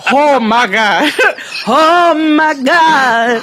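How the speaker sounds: high, slow, laughing oh my God; drawn out in laughing shock; laughing disbelief. Real, not acted.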